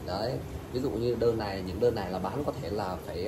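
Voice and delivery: ordinary talk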